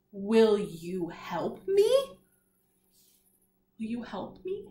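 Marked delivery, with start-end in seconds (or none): 0.1-1.9 very slow, each word drawn out, rising at the end; slow read-along. Shown as a demonstration. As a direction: stretch each word so the class can follow. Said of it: "Let's read it all together".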